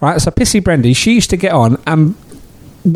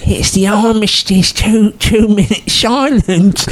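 woman's voice; 4 semitones higher, slower than usual. Retold or imitated. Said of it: "a woman going".

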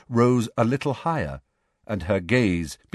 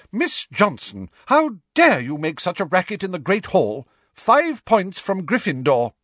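McGonagall voice; 10 semitones higher, 4 dB louder than usual. A narrator's reading.

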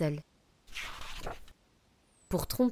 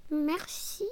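squeaky mouse thanks; 8 semitones higher than usual. Acted. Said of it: "dit la souris".

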